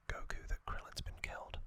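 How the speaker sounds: ordinary delivery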